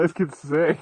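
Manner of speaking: put-on loud amazed kid praise; mock admiring. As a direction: say it like a hyped teenager. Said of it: "It's like".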